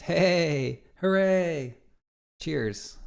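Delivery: ordinary delivery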